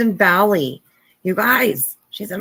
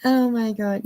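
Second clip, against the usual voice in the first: ordinary talk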